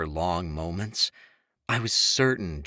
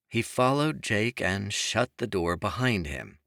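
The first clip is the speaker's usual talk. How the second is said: usual speaking voice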